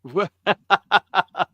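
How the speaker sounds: high, staccato burst of laughter; amused laugh. Real, not acted. Host reacts to co-host's joke about his daughter, real amused laugh. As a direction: crack up at the joke.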